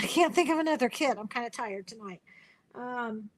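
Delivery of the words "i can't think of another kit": laughing. Real, not acted. Laughing at her own blank.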